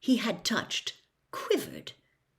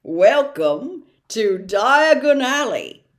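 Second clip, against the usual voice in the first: louder and slow, proudly announced; a giant unveiling a wonder; grand welcome; 3 semitones higher, 9 dB louder, slower than usual. Acted. Hagrid proudly presents the street.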